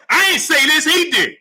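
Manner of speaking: high-pitched, exasperated self-defence; indignant protest. Real, not acted. Host defending himself against chat's accusation, indignant. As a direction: throw the blame back hard.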